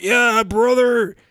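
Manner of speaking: high, loud, slow drawn-out bro call; cheering back at the birthday drunk; hyped driver reply. Retold or imitated. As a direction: hype it back like a rock fan. Valet driver answers his shout.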